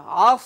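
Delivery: আস read as one joined syllable. Shown as a demonstration. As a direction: say আস with the letters blended together. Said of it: "মিলিয়ে পড়তে হবে".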